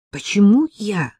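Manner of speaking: resisting, protesting. A narrator's reading. Friend wriggles out of the errand, protesting. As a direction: push the task away. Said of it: "отбивалась я".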